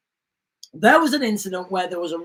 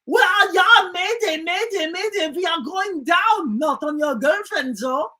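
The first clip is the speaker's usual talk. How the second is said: higher hammed-up German accent; mock German mayday; 7 semitones higher than usual. German pilot radios mayday going down, mock German accent. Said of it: "the Germans are like".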